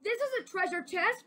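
high, fast, thrilled discovery; excited cry. Acted. Lewis cries out at the treasure chest, thrilled. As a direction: blurt it out at the find. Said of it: "cried Lewis".

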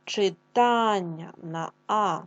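читання said slowly, the А held long; stress on А in читання. Shown as a demonstration. Teacher to class, slowly stressing читання on А. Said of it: "Проговоримо".

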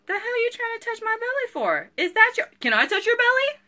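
high mock-indignant squeal; imitating an indignant pregnant woman. Seller mocks her reaction to strangers touching her belly, high and squeaky. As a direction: squeak it out like an outraged mom-to-be. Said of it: "if I don't know 'em".

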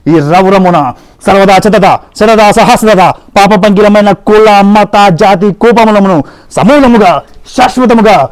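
loud, rolling mythological-film declamation; grand film dialogue. Acted. The human, defending humankind to Yama, declaims loudly like a film hero. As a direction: thunder it like a classic Telugu screen hero. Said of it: "నేను dialogue చెప్తా".

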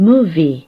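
movie said slowly and clearly as MOO-vee; teacher modelling movie for learners; model pronunciation. Shown as a demonstration. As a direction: say movie cleanly, stress on the first syllable. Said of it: "ออกเสียงว่า".

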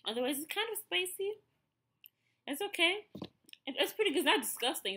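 bright rush of delight at a tasty bite; excited. Real, not acted. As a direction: lift the voice, happily surprised it works. Tasting candy, pleased reaction.

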